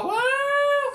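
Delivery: very high yelping woo; excited whoop. Real, not acted. Gamer whoops at the monster, high excited yelp. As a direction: let out a high gleeful whoop.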